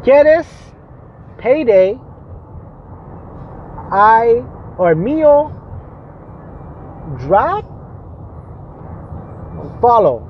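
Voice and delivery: halting broken Spanish, long pauses between words; español entrecortado, con pausas largas. Real, not acted. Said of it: "I don't know how to speak Spanish".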